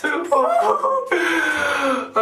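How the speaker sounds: gleeful mad cackle; manic laugh. Acted. Madman loving his own lunacy, manic laugh. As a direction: let a wild giggle spill out.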